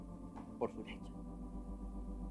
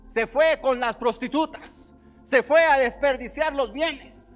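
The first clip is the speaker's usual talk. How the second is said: loud slow accusation; 10 semitones higher, 12 dB louder, much slower than usual. Acted. Elder son accusing the brother.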